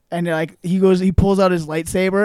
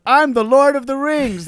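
lightly hammed-up, higher-pitched proclamation; mock movie line; 7 semitones higher, 4 dB louder than usual. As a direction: proclaim it like a silly title drop. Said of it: "when he goes".

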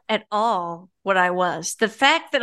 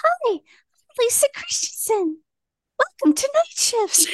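much higher, chirpy mock announcer voice; eager young radio host. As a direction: play the overeager teen on air. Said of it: "And I'm like".

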